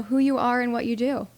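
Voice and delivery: normal talking voice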